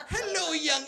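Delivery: put-on high, leering old-timer hello; lightly hammed-up elderly greeting; creepy old man greeting. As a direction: leer at her like an old man.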